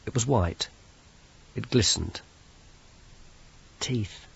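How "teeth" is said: breathless whispered shock; a hushed, stunned whisper; horrified whisper. Acted. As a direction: whisper it in dread. Susan sees the heap of teeth.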